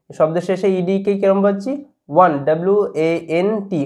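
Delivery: ordinary delivery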